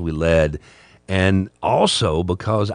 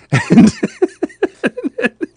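high burst of laughter; laughing out loud; 6 dB louder than usual. Real, not acted. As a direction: crack up at the memory. Laughing at his own story.

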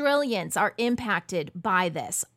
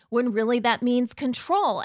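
ordinary talk

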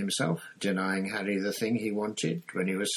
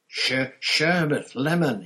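breathless, slow, high gasped password; panting; 7 semitones higher, 8 dB louder, slower than usual. A narrator's reading. Harry, out of breath, panting the password at the gargoyle. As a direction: gasp the words out after a sprint. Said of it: "he panted at it".

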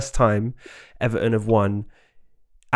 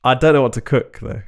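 ordinary talk

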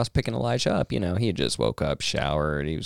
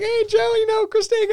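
calling out; 4 dB louder than usual. Retold or imitated. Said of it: "I was like".

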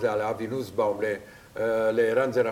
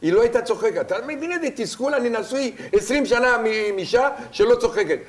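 exasperated rant; 8 semitones higher, 4 dB louder than usual. Real, not acted. Ranting about wife who never laughs.

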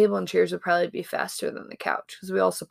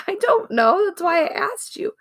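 put-on whiny kid, laughing; 12 semitones higher, 4 dB louder than usual. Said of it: "He said".

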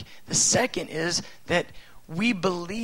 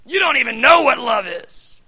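higher, louder furious yell; shouting; 8 semitones higher, 9 dB louder than usual. Pretend. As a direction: shout right in the child's face. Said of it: "What if I shouted in his face".

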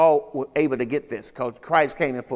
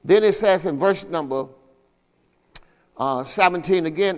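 normal talking voice

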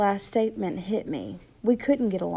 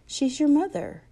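higher and louder, insisting; urgent, raised voice; 8 semitones higher, 5 dB louder than usual. A narrator's reading. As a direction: push back, raising the voice. Urging Johnny to see mother.

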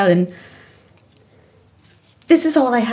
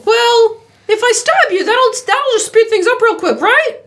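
high menacing villain; gleeful high-pitched threat; 9 semitones higher than usual. Acted.

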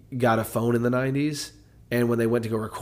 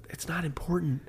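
whispered aside; 5 semitones higher, 10 dB quieter than usual. Real, not acted. Aside about phone.

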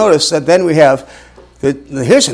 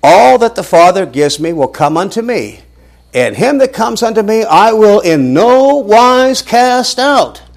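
rising, slowing, louder reading; emphatic scripture reading; 8 semitones higher, 5 dB louder than usual. Acted. Preacher reading the verse to his class, building emphasis.

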